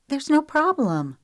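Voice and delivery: sharp, high and loud retort; fired back. Acted. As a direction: snap it straight back at him. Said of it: "she fired back".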